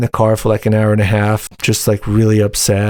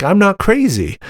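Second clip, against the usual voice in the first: excited relief; 9 semitones higher than usual. Real, not acted. Realizing others share his doubts, a high excited burst. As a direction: pitch jumps way up in sudden relief.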